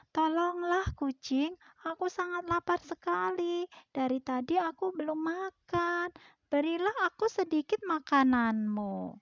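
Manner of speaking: high begging duck voice; pleading duck. Acted. Duck begging the stingy cat again, high plea. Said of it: "ini bebeknya".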